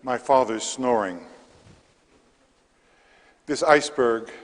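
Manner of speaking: measured verse with pauses between lines; poetry reading. Acted. Poet reads to a library audience, measured poetry reading. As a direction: read the poem aloud, each phrase set apart. Reads own poem aloud.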